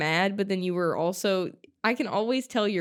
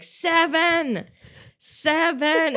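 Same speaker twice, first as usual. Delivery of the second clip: high, loud insistent shouts; drunk yelling; 9 semitones higher, 4 dB louder, much slower than usual. Retold or imitated. Drunk friend in the trunk insisting on the order, shouting. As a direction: bark the number like a stubborn drunk.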